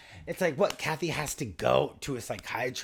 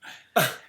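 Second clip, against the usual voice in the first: mock gasp; 5 semitones higher, 7 dB louder than usual.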